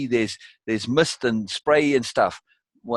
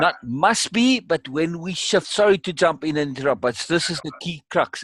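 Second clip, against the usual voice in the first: higher, urgent jumping in; excited interjection; 5 semitones higher than usual. Real, not acted. Coach interrupts guest at the key word "must be", excited. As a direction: pounce on the key point, pitched up.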